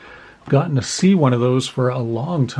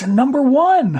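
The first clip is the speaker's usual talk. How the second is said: bursts out high and loud with delight; excited call; 13 semitones higher, 6 dB louder than usual. Real, not acted. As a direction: shout it out like a big find. Card pull announced with delight.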